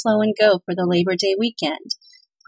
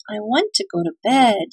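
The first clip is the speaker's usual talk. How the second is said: ordinary delivery, nothing marked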